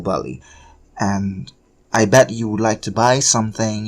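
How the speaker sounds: ordinary talk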